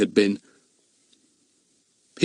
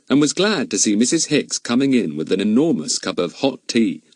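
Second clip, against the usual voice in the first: ordinary delivery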